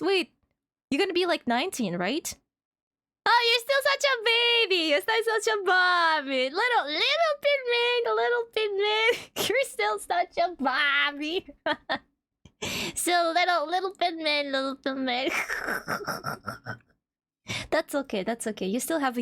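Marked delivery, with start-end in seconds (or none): 3.2-9.1 put-on high cooing baby talk to a young viewer; teasing high baby voice. 9.5-15.3 put-on a lighter baby-talk tease; gentle mocking coo. Teasing baby talk continues.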